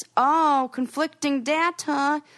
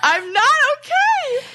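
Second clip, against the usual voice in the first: mock panic; 13 semitones higher, 6 dB louder, slower than usual. Joking panic about robot.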